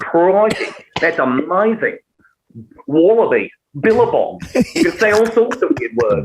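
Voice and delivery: big, long-vowelled Australian accent; broad Australian. Shown as a demonstration. Showing off silly Australian words, exaggerated Australian accent. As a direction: lean into a playful broad Australian. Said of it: "then it becomes Australian".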